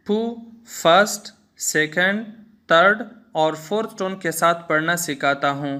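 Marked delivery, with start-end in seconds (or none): none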